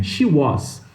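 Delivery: SHE WAS with WAS as "u-ÓSS"; learner's open O in WAS; Brazilian mispronunciation. Retold or imitated. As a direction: end WAS on S, not Z. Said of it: "E aí você fala".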